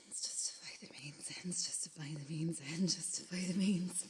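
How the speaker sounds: fast, soft whispered chant; whispered mantra. Acted. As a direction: murmur it like a litany.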